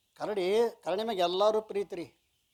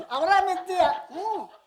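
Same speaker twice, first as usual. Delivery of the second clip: hearty high greeting; 9 semitones higher, 5 dB louder than usual. Retold or imitated. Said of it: "ಕೇಳ್ತಾನೆ".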